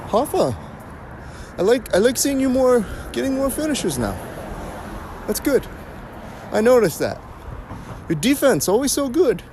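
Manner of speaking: high, put-on instructor voice. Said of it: "looked at me and goes".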